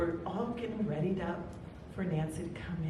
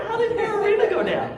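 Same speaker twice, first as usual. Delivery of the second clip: excited laughing; 11 dB louder, faster than usual. Real, not acted. Laughing host opener.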